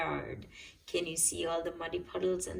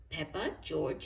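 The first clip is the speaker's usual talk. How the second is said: ordinary delivery, nothing marked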